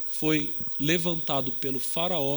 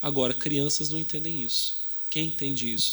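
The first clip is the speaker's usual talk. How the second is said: ordinary talk, nothing marked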